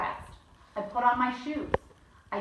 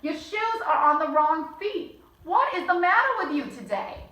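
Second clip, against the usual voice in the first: stern father; 6 semitones higher, 5 dB louder than usual. Acted. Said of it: "said my father".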